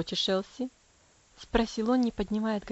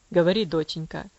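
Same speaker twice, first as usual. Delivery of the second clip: low, tender father's voice; 6 semitones lower, 6 dB louder than usual. Acted. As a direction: coax her gently. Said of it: "сказал он тихим, полным нежности голосом". (нежно, низким голосом)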